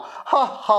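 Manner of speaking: short put-on ha ha after the joke; staged chuckle; mock laugh. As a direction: laugh at your own punchline. Teacher laughs at his own joke.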